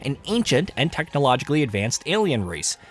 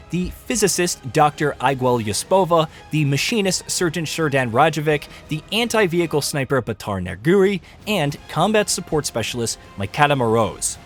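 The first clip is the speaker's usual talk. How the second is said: ordinary talk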